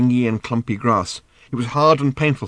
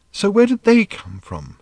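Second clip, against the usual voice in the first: put-on higher, slow girlish question; lightly voiced puzzled girl; curious teen girl; 10 semitones higher than usual. A narrator's reading. Random asks, slow and high.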